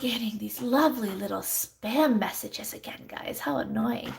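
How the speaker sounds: breathy annoyed whisper under her breath; quick whispered complaint; whispered aside. Real, not acted. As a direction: mutter it privately to the viewers. Aside about spam messages.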